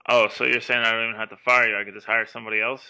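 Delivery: loud, rapid indignant rant; mock indignation. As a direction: rail at an employee, fast and loud. Boss reacts indignantly to mechanic.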